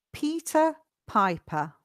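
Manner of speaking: slow, separated words for repeating. Shown as a demonstration. Teacher leads students line by line, slowly. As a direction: model it slowly, a gap between words. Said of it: "Let's do it slowly together".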